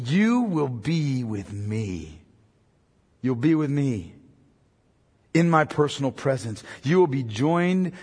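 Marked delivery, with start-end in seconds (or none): 0.1-4.2 tender promise. Shown as a demonstration. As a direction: say it gently, friend to friend. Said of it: "He speaks as a friend to a friend".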